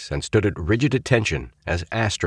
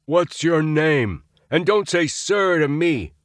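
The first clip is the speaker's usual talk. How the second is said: roared; 10 semitones higher, slower than usual. A narrator's reading. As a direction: roar at the cadet. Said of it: "roared Astro".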